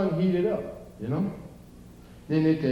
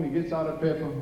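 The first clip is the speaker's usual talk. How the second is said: ordinary talk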